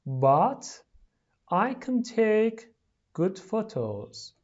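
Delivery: slow, carefully separated words. Shown as a demonstration. Teacher reading for pupils to repeat, slowly. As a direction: say each word slowly and distinctly.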